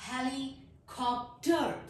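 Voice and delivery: helicopter very slow, as heli, cop, ter; split into syllables. Shown as a demonstration. Teacher showing three syllables, word broken apart. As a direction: break helicopter into three parts with gaps. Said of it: "तीन syllables मैंने इसके बना लिए".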